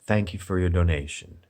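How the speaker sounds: lightly mocking calm donation thanks; calm and peaceful. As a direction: imitate a YouTuber's hushed, placid gratitude. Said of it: "very calm and peaceful about it".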